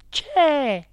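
high-pitched exclaimed woman's voice; Geltrude's murmur. A narrator's reading. Directress at the séance as the table jumps, high stage murmur. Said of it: "mormorò la signora Geltrude".

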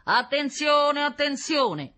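high, loud, drawn-out monotone call; loudspeaker announcement. A narrator's reading. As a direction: boom it out like a police loudspeaker. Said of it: "ricominciò".

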